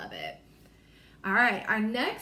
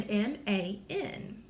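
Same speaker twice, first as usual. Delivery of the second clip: letters spaced out slowly; slow spelling; slower than usual. Shown as a demonstration. Teacher to class, spelling man letter by letter. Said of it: "So we spell man".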